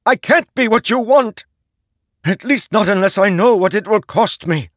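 very high, loud, desperate shrieking; shrieking at the herald who forged him; anguished shriek. A narrator's reading. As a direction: tear it out of him in despair. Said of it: "Szeth shrieked".

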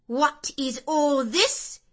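high, loud, slow commanding question; peremptory demand. Acted. Mrs Reed arriving to scold the servants, peremptory. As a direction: cut through the noise with authority. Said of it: "demanded another voice peremptorily".

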